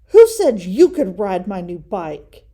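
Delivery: loud, indignant demand; angry shout. Acted. Brother scolding Fred for riding his new bike, loud angry demand. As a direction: keep yelling at the culprit. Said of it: "shouted Brother".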